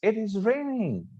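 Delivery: high-pitched surprised exclamation. Shown as a demonstration. Teacher showing students a tone, surprised. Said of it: "I'm, like, surprised".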